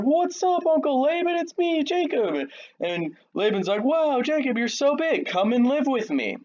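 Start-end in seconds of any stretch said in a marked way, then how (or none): 0.0-2.5 much higher, bright put-on youth voice; a grinning kid calling out to his uncle; eager young Jacob. Nephew Jacob greeting his uncle Laban, in a high eager put-on voice. 3.8-6.4 jolly uncle. Retold or imitated. Laban welcomes his nephew.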